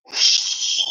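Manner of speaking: long voiceless cat hiss; imitated cat hiss. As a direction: hiss like a cat warning off a dog. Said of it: "and the cat went".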